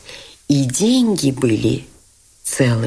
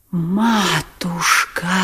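a drawn-out anguished wail; old man crying out in despair; much slower than usual. A narrator's reading. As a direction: wail it out, slow and pained. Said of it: "всё ещё вопил Лебедев".

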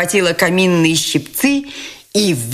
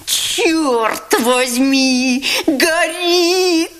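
much higher, slow, panicked wail; alarmed cry; 12 semitones higher, much slower than usual. A narrator's reading. Fist-fighter seeing the fire, panicked high cry. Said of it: "вскричал он".

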